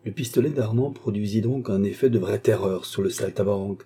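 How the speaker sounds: usual speaking voice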